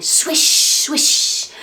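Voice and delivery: breathy wind swish. Acted. Reading to a child, a breathy swish.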